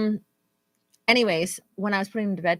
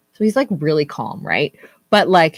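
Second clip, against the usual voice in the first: ordinary talk, nothing marked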